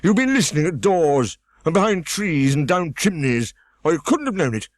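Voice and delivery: high, loud indignant outburst; sudden passion. A narrator's reading. Humpty Dumpty accusing Alice of eavesdropping, high and loud. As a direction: accuse her hotly, voice rising. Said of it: "breaking into a sudden passion".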